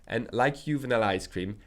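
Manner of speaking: normal talking voice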